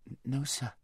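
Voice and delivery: soft, faltering answer; timid stammer. A narrator's reading. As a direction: stammer meekly to the lion. Girl nervously answers great lion.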